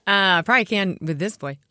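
normal talking voice

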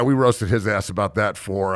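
ordinary talk

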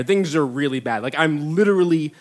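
ordinary delivery

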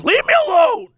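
high, loud yelling customer; hammed-up outraged shout; mock furious shout. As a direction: yell to be left alone. Customer snaps at cashier.

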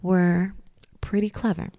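hushed whispered aside; whispering. Acted. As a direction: whisper it like a secret. Whispered aside about Pilgrims.

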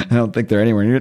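ordinary talk

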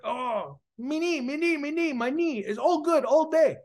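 high-pitched, excited broken English; excited yapping. Retold or imitated. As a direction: imitate an overjoyed man babbling good news. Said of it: "he's so excited and he's yappering off".

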